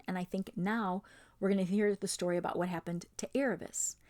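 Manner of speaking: ordinary delivery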